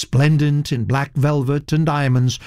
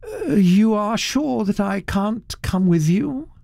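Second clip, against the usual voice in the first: wistful; 9 semitones higher than usual. Acted. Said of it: "he said wistfully".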